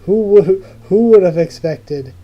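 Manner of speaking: loud, stunned cry of disbelief; shocked outburst. Real, not acted. Reader reeling at the death page, shouting in shock. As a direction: blurt it out, floored by the twist.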